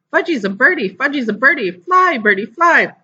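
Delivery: put-on high, gleeful little boy; high child's delighted chant; excited toddler. A narrator's reading. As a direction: cheer yourself on like a small child flying. Toddler pretends to fly.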